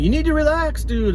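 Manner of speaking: mock calm friend. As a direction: toss it off like nothing's wrong. Moses calms the nervous narrator.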